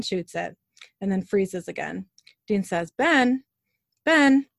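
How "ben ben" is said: higher, louder repeated name; urgent call. A narrator's reading. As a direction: call to snap someone out of it. Dean calling to frozen boy.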